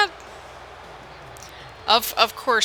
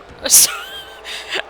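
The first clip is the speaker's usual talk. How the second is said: high disbelieving laugh. Real, not acted. After yelling at fireworks.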